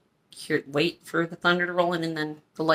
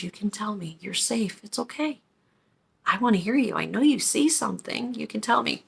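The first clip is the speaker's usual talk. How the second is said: soft, slightly raised coaxing voice; gentle reassurance; 3 semitones higher, 5 dB quieter than usual. Retold or imitated. Old lady kneeling to a child, gently reassuring. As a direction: softly coax a frightened child to open up.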